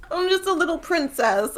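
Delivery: mock helpless high whine; lightly hammed-up damsel; whiny princess. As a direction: play the helpless little princess, mockingly. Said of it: "And Gwen's like".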